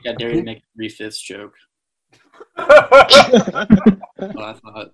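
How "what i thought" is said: laughing. Real, not acted. Laughing taunt.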